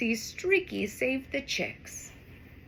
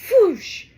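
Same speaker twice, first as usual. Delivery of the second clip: loud whoosh; 8 semitones higher, 10 dB louder than usual. Acted. Sound effect in story.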